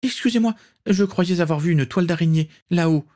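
high, flustered maid-like stammer; stammering excuse. A narrator's reading. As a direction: caught out, fumble for an excuse. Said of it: "bredouilla Daisy".

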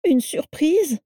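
high, incredulous "surprise"; dismayed echo. A narrator's reading. Carla echoes Vera's news of a surprise, dismayed. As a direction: throw "surprise" back high as a question.

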